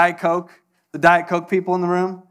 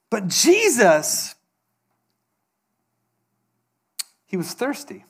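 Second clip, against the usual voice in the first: earnest sermon delivery with long pauses; preaching. Acted. Pastor confessing weakness to the congregation, earnest preaching. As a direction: confess it to the room, pausing.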